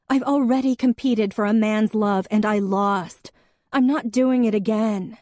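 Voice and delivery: heated outburst. Acted. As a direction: let the hurt boil over into a near shout. Em's heated outburst at Jack.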